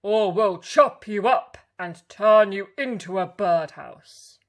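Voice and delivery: loud bellowing threat; loud booming threat; threatening woodcutter. A narrator's reading. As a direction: scare the wolf off.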